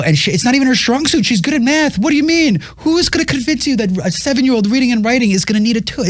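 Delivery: high, exasperated disbelief; incredulous outburst. Retold or imitated. Father protesting to his wife about a tutor, high incredulous outburst. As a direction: fire back at her in high-pitched disbelief.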